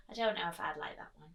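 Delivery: quick conspiratorial whisper; whispered aside. Real, not acted. Teacher confiding to the children, in a quick whisper. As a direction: lean in and whisper it fast.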